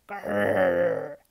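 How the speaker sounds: low rumbling growl; dog growl. Acted. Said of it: "added Fang".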